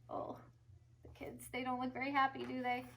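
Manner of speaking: hushed whisper; whispered aside. A narrator's reading. Narrator whispers to the kids. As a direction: confide it softly.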